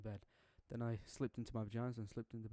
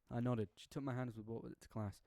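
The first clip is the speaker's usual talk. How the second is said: ordinary delivery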